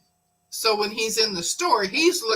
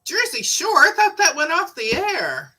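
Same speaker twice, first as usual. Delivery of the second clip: surprised; 8 semitones higher, 4 dB louder than usual. Retold or imitated. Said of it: "I'm like".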